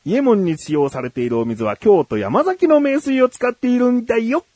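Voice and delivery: high, sing-song teacher voice; lesson voice. Pretend. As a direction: explain it like a kids' lesson. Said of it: "レッスンツーいきます。".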